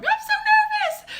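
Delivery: high squealed panic; nervous squeal. Retold or imitated. Texting her friends before the show in a nervous squeal. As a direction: squeak it out like a jittery wreck.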